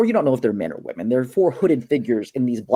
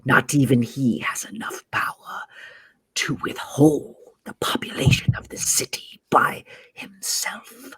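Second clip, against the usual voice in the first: raspy unvoiced whisper of a snake villain; sinister serpent creature; 3 dB quieter than usual. Game master voices a serpent character.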